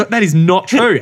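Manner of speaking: hammed-up indignant denial; mock flat denial. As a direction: play the friend insisting it never happened. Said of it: "he goes".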